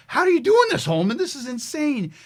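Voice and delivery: high, loud astonished coach; a coach who can't believe what he just saw; amazed coach. Retold or imitated. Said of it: "He's like".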